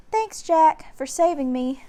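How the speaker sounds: put-on bright young girl's gratitude; little sister. A narrator's reading. Annie thanking Jack, high girlish voice. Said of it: "said Annie".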